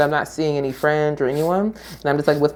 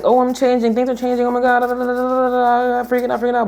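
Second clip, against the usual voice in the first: much higher, rushed mock panic; panicked self; 8 semitones higher, faster than usual. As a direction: act out spiraling panic. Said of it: "versus just being like".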